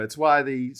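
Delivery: ordinary talk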